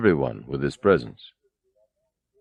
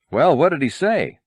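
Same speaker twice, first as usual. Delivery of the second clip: concerned shout; 8 semitones higher than usual. A narrator's reading. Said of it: "Han shouted with some concern".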